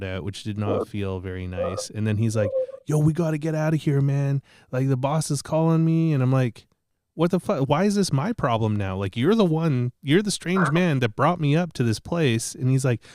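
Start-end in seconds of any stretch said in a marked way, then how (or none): 2.9-6.1 imitating a hurried guy, raised and louder; mock panic, pitched up and pressing; urgent worker. Acting worker panicking about boss. 7.1-12.5 mock protest, higher and louder; acted-out indignation. Acting indignant reply to worker.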